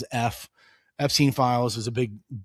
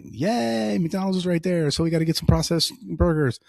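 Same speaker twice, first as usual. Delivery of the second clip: higher mock kid cheer, a little fast; excited kids; 3 semitones higher than usual. Dad mimics his kids cheering at McDonald's, higher mock excitement.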